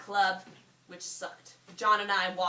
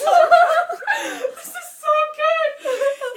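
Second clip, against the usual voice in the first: laughing; 17 dB louder, much slower than usual. Real, not acted. Reader cracking up at own story.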